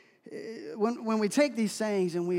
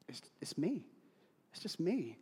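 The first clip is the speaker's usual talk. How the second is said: almost a whisper, softer; whispered confession; 3 semitones lower, 7 dB quieter than usual. Real, not acted. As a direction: confess it under your breath. Confessing biggest obstacle.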